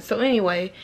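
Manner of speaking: ordinary delivery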